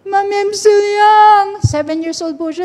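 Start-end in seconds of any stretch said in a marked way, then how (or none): 0.1-1.6 high, drawn-out mock sob of a daughter; imitated crying child. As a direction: whine tearfully like a small child. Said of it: "iyak siya na iyak". (ginagayang iyak ng bata)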